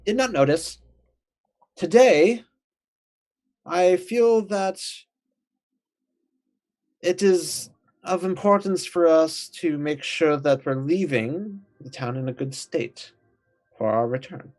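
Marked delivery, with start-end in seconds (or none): 1.8-13.1 higher, pompous, deliberate speech; Frax's formal voice. Acted. Frax addressing the party, grand and formal. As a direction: proclaim it like a self-important cleric.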